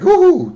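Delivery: very high, loud ooh; mock wincing ooh. Comic stung by a tough crowd, high mock ooh. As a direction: let out a high comic hoot.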